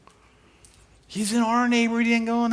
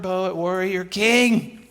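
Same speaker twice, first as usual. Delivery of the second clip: normal talking voice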